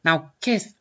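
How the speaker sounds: attempted lisping boxer voice, lightly done; half-hearted lisping impression; Mike Tyson. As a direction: lisp the S into TH, "Kith". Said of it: "I don't know how to do a Mike Tyson voice".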